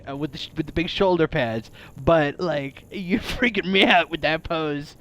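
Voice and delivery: ordinary talk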